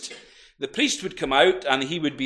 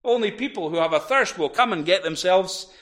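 ordinary delivery

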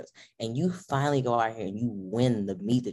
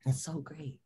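ordinary delivery

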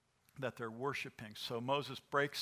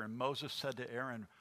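ordinary talk

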